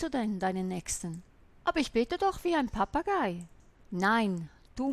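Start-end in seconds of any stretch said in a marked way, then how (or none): 1.6-3.5 higher, mocking voice of a lazy believer; mock protest; whiny excuse. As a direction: parody the objector, much higher. Imitating an excuse-making believer.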